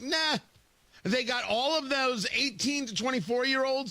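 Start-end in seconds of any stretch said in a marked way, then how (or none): 0.0-0.4 high mock shrug of a word; dismissive nah. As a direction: toss it off like it never mattered. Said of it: "is like".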